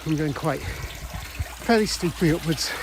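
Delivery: puffing while climbing a hill; out of breath. Real, not acted. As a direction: talk between heavy breaths. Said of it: "sorry for the heavy breathing".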